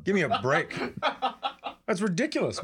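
normal talking voice

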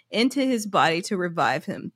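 ordinary delivery

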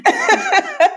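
laughing through the refusal. Real, not acted. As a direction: laugh off the idea. Laughing at her own reluctance.

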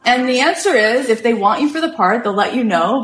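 high, emphatic mock rebuke; imitated officious gatekeeper; indignant receptionist. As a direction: brush off a pushy caller in a lifted voice. Office woman keeps rebuking the caller.

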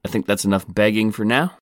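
usual speaking voice

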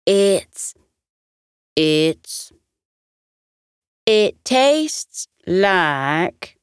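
slow amazed stammer; words failing him in wonder; astonished halting start; stunned, halting. A narrator's reading. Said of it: "his eyebrows shot up".

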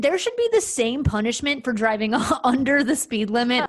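real laughter mid-sentence; laughing through it. Real, not acted. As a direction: laugh your way through the joke. Laughing while pitching a joke law.

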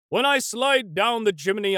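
ordinary talk

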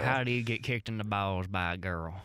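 low mock Southern voice; southern accent. Shown as a demonstration. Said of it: "read this in a southern accent".

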